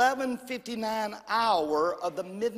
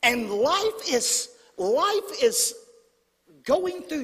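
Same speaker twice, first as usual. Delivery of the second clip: slow, loud and high; preacher rising to a cry; preaching emphasis; 6 semitones higher, 5 dB louder, slower than usual. Acted. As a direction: stretch it out and build the force.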